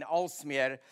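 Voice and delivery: a foreigner's misplaced emphasis on Alsmeer; stress on the wrong syllable. Real, not acted. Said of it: "I know I'm gonna pronounce it wrong".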